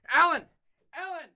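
high sharp yell; calling out. Acted. Searching for Alan, high yell. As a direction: yell his name.

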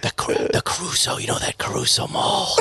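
breath-only whisper, passing on gossip; imitated secretive whisper. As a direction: whisper it confidentially, mimicking a friend. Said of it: "then he talks like that".

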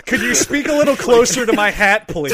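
loud awkward request. Acted. Acting spy on date.

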